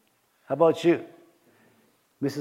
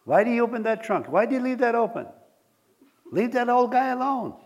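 mock scolding; 8 semitones higher than usual. Scolding imagined nosy neighbour.

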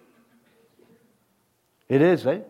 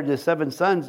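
ordinary talk, nothing marked

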